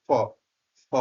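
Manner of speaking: ফ, ফ with air through both lips; ফ ফ, lip-to-lip breath sound; Bengali ফ demo. Shown as a demonstration. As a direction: puff ফ between the lips. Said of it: "বাতাস ... বের হয়ে আসে".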